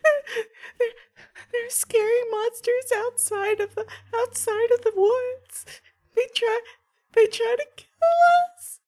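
put-on very high, halting little-kid voice; crying scared child. Child telling a stranger about monsters, scared crying child voice. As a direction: stammer through acted tears like a frightened small child. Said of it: "he's crying".

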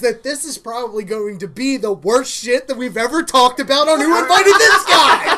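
loud, high, building to a laughing shout; real thrilled yell, breaking into laughter; excited hype. Real, not acted. Host hyping the worst episode ever, excited shout.

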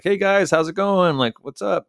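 high, loud, overly cheerful hello; a bright fake-casual hey to the guys who caught him; breezy greeting. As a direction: play it cool like nothing's wrong. Caught, plays it cool.

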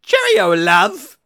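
squeaky high British "cheerio, love"; loud, high, cartoonish British accent; a silly British farewell, loud and high; mock British accent. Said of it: "Say cheerio, toxic fans".

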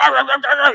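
high loud mock yell. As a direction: bark nonsense like a ranting pundit. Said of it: "he's just, like, yelling".

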